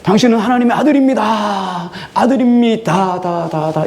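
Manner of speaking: high ringing shout, drawn out; demon shouting. Retold or imitated. Preacher acting the demon shouting so all hear. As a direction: shout it to the whole crowd. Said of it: "큰 소리를 외친 이유가 뭘까요?".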